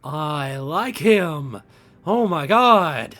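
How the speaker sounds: delighted. Real, not acted. Delighted reaction to the NPC.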